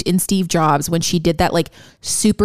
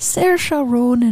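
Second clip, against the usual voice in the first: higher, playful Irish lilt; mock Irish accent; 4 semitones higher, much slower than usual. Tossing the star's name back, mock Irish accent. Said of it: "He's not Irish".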